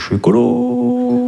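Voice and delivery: high, slow squeaky affirmation; a self-proclaimed ecologist, overplayed; mock high declaration. Shown as a demonstration. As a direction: say "je suis écolo" in a high, slow voice. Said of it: "repeats the line in varied ways".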